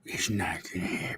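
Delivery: whispered, breathy Biden impression; hushed mock-Biden whisper. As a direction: drop to a secret whisper like old Joe. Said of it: "And I was like".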